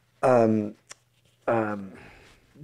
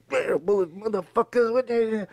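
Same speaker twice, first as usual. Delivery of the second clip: high, softer garbled cursing; imitating pissed-off; 7 semitones higher, 6 dB quieter than usual. Late actor cursing as he walks in, imitated pissed-off grumbling. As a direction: grumble mock curses under your breath, pitched up. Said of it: "I was fucking pissed off".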